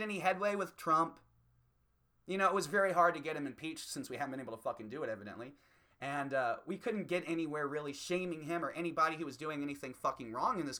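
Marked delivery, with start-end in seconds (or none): none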